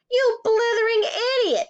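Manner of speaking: high, loud burst of outrage; furious headmistress exploding; angry shout. A narrator's reading. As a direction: shout an insult at a pupil. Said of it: "shouted the Trunchbull".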